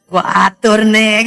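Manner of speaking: put-on baby's inner voice. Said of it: "Dalam hatinya".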